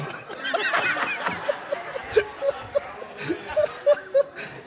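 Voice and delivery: high, breathy, voiceless laugh; real high laugh bursting out; high breathless laugh. Real, not acted. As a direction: laugh helplessly at your own joke. Laughing at own joke.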